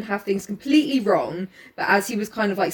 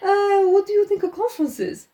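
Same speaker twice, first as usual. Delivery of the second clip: mock Poirot; 6 semitones higher than usual. Poirot mocking croissant mix-up.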